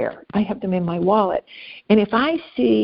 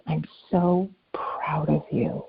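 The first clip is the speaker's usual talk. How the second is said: slow, soft whisper; hushed praise; 3 semitones lower, 5 dB quieter, slower than usual. Retold or imitated. Stranger leaning in behind an embarrassed mom, hushed and slow.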